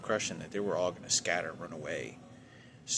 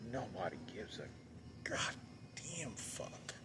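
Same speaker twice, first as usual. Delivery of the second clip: real despair, barely voiced; bitter whisper; 3 semitones higher, 9 dB quieter, slower than usual. Real, not acted. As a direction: drop to a slow, hushed, despairing whisper.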